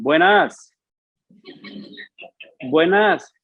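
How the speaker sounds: calling out at the gate. Retold or imitated. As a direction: call it across the yard to the house.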